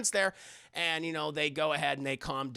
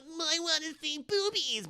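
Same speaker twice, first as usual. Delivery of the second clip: put-on high, childish begging voice; mock pleading; 10 semitones higher than usual. Mocking a man begging for a flash, high pleading.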